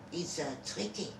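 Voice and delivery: ordinary delivery, nothing marked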